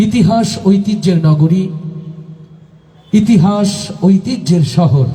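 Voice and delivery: ordinary talk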